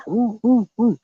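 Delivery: high hooting owl call. Retold or imitated. Imitating cultists calling the owl, high hoots. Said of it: "they be calling the owl".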